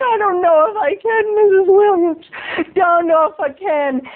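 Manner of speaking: high-pitched reluctant protest; whiny reluctance. Acted. Boy resisting neighbor's request, reluctant.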